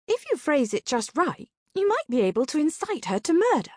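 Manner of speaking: high-pitched, lilting sidekick voice; pitched well up, slyly suggesting; Sabrina. A narrator's reading. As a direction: voice the scheming partner up high. Sabrina's character voice in narration.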